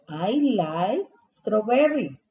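slow, clear classroom model of I like strawberry; slow model sentence. Shown as a demonstration. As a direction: say it slowly for the kids to copy. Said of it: "entonces digo". (despacio y claro, como modelo)

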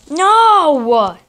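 loud, high cry of no; dismayed shout. Real, not acted. Player choking in the match, dismayed shout. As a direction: wail it in dismay.

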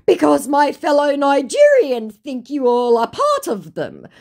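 high, loud insistence; mock indignant scammer. Scammer justifying himself to her, mock indignant. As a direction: argue back with hammed-up conviction. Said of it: "To which he goes".

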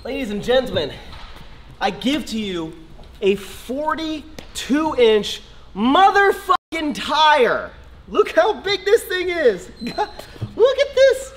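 high, slow hammed-up presenter; mock grand unveiling; showman announcement. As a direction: unveil it like a game-show host.

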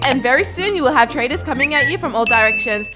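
soft, bright sponsor read. Acted. Gamer reads the sponsor ad, soft and upbeat. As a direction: sell the trading site softly.

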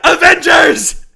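high, loud superhero rallying call; rally the troops, hammed up; hammy battle cry.

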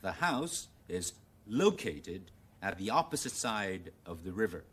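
slow, careful American model reading; American English. Shown as a demonstration. Teacher rereading example for contrast, American English. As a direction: read it in American English, LOcated stressed early. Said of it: "而家試下用美語嚟讀啦".

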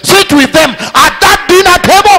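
loud, high declamation; impassioned shouted sermon; full-force preaching. Real, not acted. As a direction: proclaim it to a crowd, phrase by phrase.

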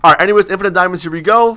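normal talking voice

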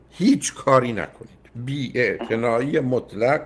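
drawn out very slowly, each word weighed; slow, deliberate. Shown as a demonstration. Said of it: "گویی این لغت اصلاً باید اینگونه ادا بشه". (آرام و کشیده)

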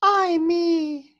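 put-on high falsetto sigh of a girl; Juliet voice. Teacher voicing Juliet for the class, high falsetto sigh. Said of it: "my, uh, Juliet voice here".

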